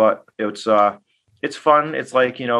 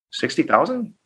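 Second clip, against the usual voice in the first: surprised; 6 semitones higher than usual. Retold or imitated. Stunned at the low price.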